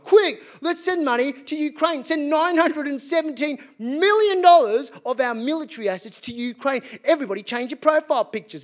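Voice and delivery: rally everyone to help; urgent politician. Retold or imitated. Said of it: "these same politicians were like".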